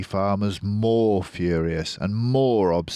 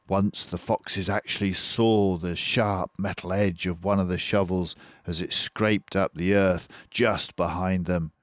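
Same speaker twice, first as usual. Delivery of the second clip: plain reading voice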